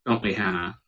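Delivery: ordinary delivery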